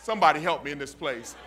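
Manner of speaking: slow, loud, drawn-out preaching; slow loud preacher calling into the den; preaching at full force. Acted. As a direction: build the call like a sermon climax. Sermon retelling the king at the den.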